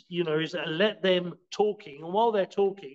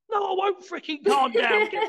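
high, hammed-up indignant yell at being told to calm down; mock angry outburst; 13 semitones higher than usual. Angry person snapping back at someone telling them to calm down, mock outrage. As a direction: play the furious person refusing to calm down, pitched way up.

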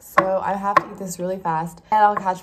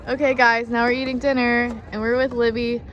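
upbeat vlog host; 4 semitones higher, 6 dB louder than usual. Real, not acted. Upbeat vlog intro to camera.

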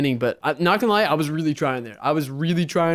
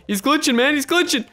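excited; 9 semitones higher than usual. Real, not acted. Opponent glitching.

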